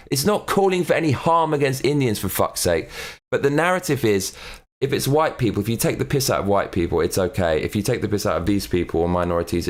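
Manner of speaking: ordinary delivery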